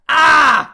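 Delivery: high, loud mock yell; overacted scream on "Ah"; hammed-up shout "Ah".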